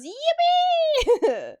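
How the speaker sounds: very high, loud, drawn-out cheer; high-pitched gleeful whoop; excited squeal. Real, not acted. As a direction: squeal it out with glee, stretched long. Cheering about loving cows.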